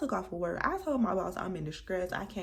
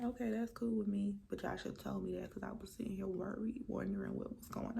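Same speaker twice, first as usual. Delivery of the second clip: whispered complaint; 9 dB quieter than usual. Retold or imitated. Retort to the manager.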